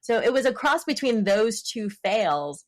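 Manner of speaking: ordinary talk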